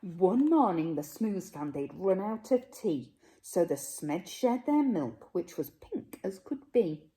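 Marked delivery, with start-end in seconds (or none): none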